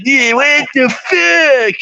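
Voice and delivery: high, slow mock tough-guy drawl; imitating Dan. Mocking how Dan talks to him, high slow drawl. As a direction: stretch it out high like the obnoxious singer. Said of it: "talk just like that".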